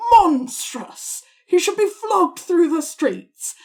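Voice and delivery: high, loud, drawn-out indignation; outraged shout. Acted. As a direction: burst out in scandalized fury. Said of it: "then he just shouts".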